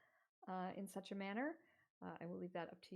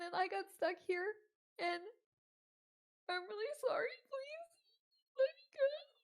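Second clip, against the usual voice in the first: put-on high, panicked begging teen voice; terrified pleading girl; 11 semitones higher, 5 dB louder than usual. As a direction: beg frantically like a scared girl caught. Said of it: "she looks terrified, and she says".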